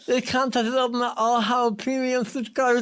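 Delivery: talking with tongue pinched; clothespin on tongue. Real, not acted. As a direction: speak with your tongue clamped. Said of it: "clothespin on my tongue".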